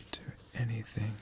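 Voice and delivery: ordinary delivery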